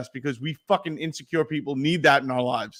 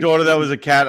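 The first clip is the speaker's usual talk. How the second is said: usual speaking voice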